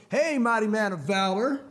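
drawn-out, teasing hail; slow it down like a cool buddy; cool movie guy. Acted. Cool guy greets his scared buddy, mocking. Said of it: "or the cool guy in the movie".

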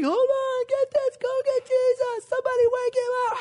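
very high, loud mock panic; panicked screaming. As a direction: scream for help over a raging storm. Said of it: "They're screaming".

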